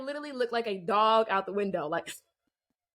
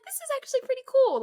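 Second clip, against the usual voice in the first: very high, louder giddy voice; realizing a celebrity drove them home; giddy thrill; 5 dB louder than usual. Retold or imitated. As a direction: let the excitement squeal out. Thrilled after the ride.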